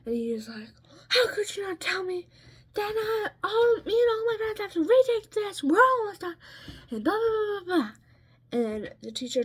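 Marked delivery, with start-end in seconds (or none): 1.1-6.4 high, loud crying yell; tearful high-pitched outburst; retold screaming. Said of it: "He's screaming". 6.9-7.9 mock yell. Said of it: "He's screaming".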